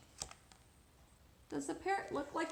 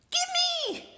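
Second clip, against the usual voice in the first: much higher, loud, greedy shriek; bratty princess demand; 9 dB louder than usual. Acted. Spoiled princess demanding the parrot, bratty high shout. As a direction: grab it like a spoiled child. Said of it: "Does she sound like she's being very nice?".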